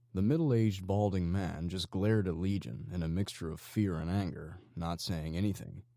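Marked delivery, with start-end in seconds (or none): none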